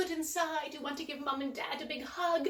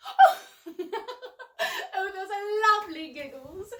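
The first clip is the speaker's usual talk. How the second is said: giggly delight; 6 semitones higher, 7 dB louder than usual. Acted. Praising children's giggles.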